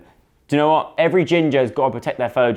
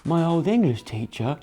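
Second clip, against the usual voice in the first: slow, higher-pitched quiet lad; Noah; 7 semitones higher, 4 dB quieter than usual. Acted. Quiet lad joking about love to the group, slow higher voice. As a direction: say it slowly in a put-on shy voice. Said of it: "says Noah. Quiet Noah".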